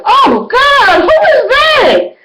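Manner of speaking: high, loud, drawn-out cry of horror; horrified shock. Retold or imitated. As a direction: shriek at her own reflection. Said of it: "I was like".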